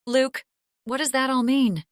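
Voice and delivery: anxious and scared, pleading; frightened. Acted. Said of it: "She looked more frightened".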